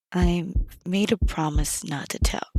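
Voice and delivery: whispered, no voice at all; secretive whisper. Acted. Yuzuki whispers to Fomar, keeping her secret. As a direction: hush it like a confession.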